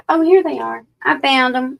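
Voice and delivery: pleased "found them" outburst; happy surprise. Real, not acted. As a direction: exclaim it as you spot them. Finds the lost cards.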